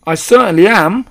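high, loud, indignant piping; boyish shout. A narrator's reading. Mouse boy chiming in from a handbag, shouting. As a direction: shout it out self-importantly. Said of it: "Bruno shouted from inside the handbag".

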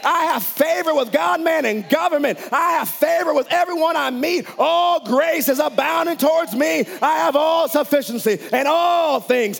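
high, loud declarations; a preacher declaring God's favor over himself; preaching at full force. Acted. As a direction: shout the confessions with fervour.

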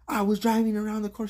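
put-on high, loud girly retelling voice; imitating the waitress. Waitress telling him about the flasher, high mock voice. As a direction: mimic a worked-up coworker. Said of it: "She's like".

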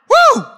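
a high, loud whoop of joy; exultant woo. Real, not acted. Preacher whoops with joy at the climax. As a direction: let out a sudden high whoop.

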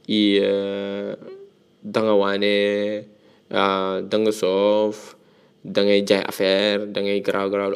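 a hint of preachy people telling you to speak proper; mocking Wolof advice. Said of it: "les gens qui vont te dire".

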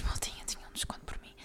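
soft unvoiced whisper; quick whispered confidential aside. Real, not acted. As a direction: lean in and whisper it like a secret.

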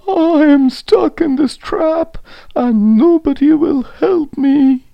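high, loud tearful complaint; hammed-up high lament; wailing lion. A narrator's reading. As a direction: wail it out as a trapped lion. Crying lion answers the mouse.

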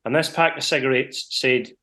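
ordinary talk

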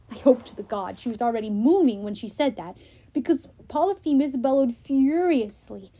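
plain reading voice

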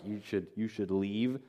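quietly hint that someone should go; discreet dismissal. Retold or imitated. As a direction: drop the voice, understated and awkward. Men discreetly urging visitor to go.

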